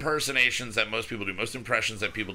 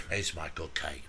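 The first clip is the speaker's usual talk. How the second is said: low, very soft English accent; breathy old English butler voice; Michael Caine impression; 7 semitones lower, 10 dB quieter than usual. Shown as a demonstration. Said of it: "you got this voice".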